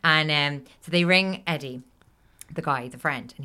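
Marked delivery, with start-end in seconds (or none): none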